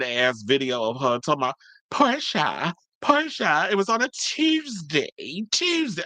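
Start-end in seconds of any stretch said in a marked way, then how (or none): 1.9-6.0 high, lilting fake posh accent on Portia; mocking Nene's new accent, up high; mock put-on accent. Vlogger mocking Nene's new accent, hammed-up. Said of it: "where did that accent come from".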